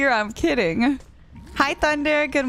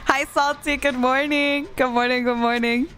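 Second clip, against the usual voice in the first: ordinary talk, nothing marked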